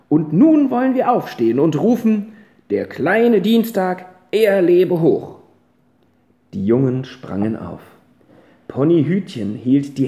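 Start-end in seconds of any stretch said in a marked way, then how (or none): 0.1-5.4 rousing call. Acted. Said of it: "sagte die Großmutter".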